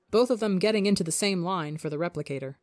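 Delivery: usual speaking voice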